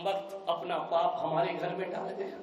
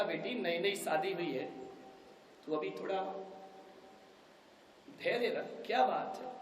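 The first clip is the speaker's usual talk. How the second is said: gentle soothing; 9 dB quieter than usual. Acted. Father gently calms his daughter.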